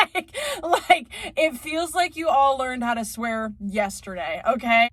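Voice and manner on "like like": words squeezed out through a laugh; a high, laughing restart. Real, not acted. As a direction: still giggling as you talk. Laughing mid-sentence.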